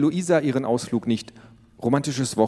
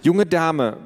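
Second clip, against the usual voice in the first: stern warning; 7 semitones higher, 4 dB louder than usual. Acted. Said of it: "begann Mama".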